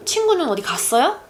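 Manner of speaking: quick, with 갔어요 stressed and rising. Shown as a demonstration. Saying it fast to sound natural, stress on 갔어요. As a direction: rush through 어디 and lean on 갔어요. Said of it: "I'm saying it a little fast".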